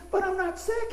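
high, puzzled protest; high baffled objection; incredulous protest. Retold or imitated. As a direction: protest in disbelief. Retold protest to doctor.

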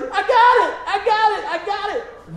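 high, loud excited cry; child on a bike shouting with joy; excited kid. Retold or imitated. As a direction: squeal it like a kid who just learned to ride. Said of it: "And I was like".

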